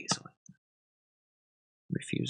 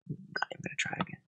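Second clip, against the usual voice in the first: usual speaking voice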